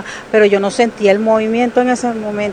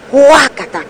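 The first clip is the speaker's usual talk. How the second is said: crash noise; 7 semitones higher, 4 dB louder, slower than usual. Retold or imitated. Imitating the ceiling crashing.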